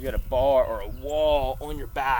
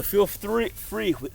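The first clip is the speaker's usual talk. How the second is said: ordinary talk, nothing marked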